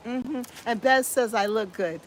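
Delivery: normal talking voice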